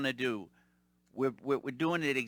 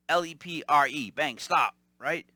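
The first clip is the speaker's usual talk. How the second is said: ordinary talk, nothing marked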